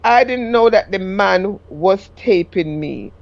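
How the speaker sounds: ordinary talk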